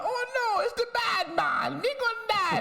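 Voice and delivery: high squealing Jamaican panic; mock panicked Jamaican voice. Imitating Connor in the lab, high mock Jamaican panic. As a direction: shriek it like a terrified movie character. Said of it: "He'll be like".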